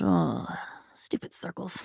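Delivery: disgusted whisper, fading almost to nothing; a barely audible grumble. Acted. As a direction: breathe the words out in disgust. Muttered disgust at the carousel.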